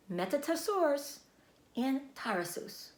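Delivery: slow, careful listing; slow naming. A narrator's reading. Spider repeats the names for the pig, slowly. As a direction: spell each name out slowly. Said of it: "Say those names again".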